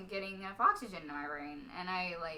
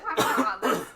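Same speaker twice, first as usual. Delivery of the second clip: ordinary talk, nothing marked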